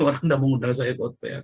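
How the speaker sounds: ordinary talk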